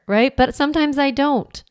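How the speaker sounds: usual speaking voice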